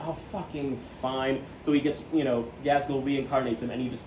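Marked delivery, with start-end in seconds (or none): 0.1-1.4 slow, fed-up sigh of a line; grudging give-in. Retold or imitated. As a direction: give in with weary annoyance. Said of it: "And he's like".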